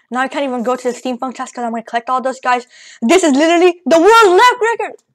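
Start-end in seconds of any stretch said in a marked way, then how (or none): 3.0-5.0 loud, high hyped exclamation; excited. Real, not acted. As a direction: shout it like a big win. Excited at record.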